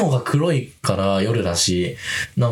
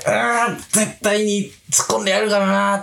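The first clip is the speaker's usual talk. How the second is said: determined; 8 semitones higher than usual. Retold or imitated. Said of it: "って決意した瞬間でもあります".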